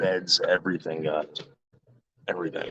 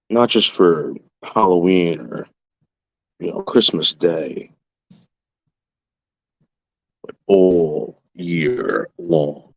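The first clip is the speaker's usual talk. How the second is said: slow taunt; 2 semitones lower, slower than usual. Real, not acted. Taunting about his lights.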